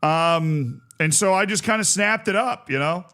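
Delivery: ordinary talk, nothing marked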